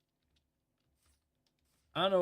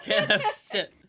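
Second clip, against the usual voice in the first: loud, higher laughing echo; 5 semitones higher, 8 dB louder than usual. Real, not acted. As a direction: laugh as you blurt it out.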